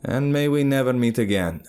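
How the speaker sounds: high, cool huntress reply. Acted. Huntress refusing the narrator's offer, cool voice. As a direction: dismiss him firmly.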